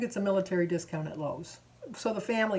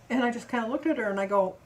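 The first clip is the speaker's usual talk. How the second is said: normal talking voice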